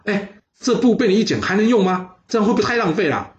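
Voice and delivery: high, startled protest; startled cry. Acted. Husband sees his wife cut the cloth, startled and high. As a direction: jump in shock at the ruined cloth. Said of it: "这岳阳一看吓一跳".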